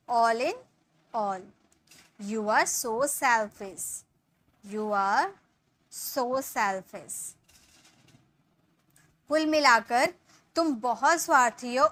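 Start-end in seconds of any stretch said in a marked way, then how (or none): 0.1-7.2 slow, clear dictation pace; slow model sentence. Shown as a demonstration. Teacher dictating the English slowly. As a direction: say each word slowly for students to repeat. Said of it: "इसके लिए बोलेंगे".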